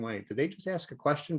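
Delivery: "ask a question" lifted into a rising question tune; confused uptalk. Shown as a demonstration. Trainer showing how uptalk confuses listeners, rising tune. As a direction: let "question" rise at the end, puzzled. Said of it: "more so if there's no question".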